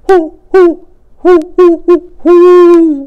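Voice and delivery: high, loud hooting owl call; long, ringing owl hoots; great horned owl call. A narrator's reading. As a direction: hoot it out like a great horned owl across the snow. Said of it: "Again he called out".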